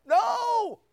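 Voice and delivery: high, loud, firm refusal; God refusing. Acted. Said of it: "and God says".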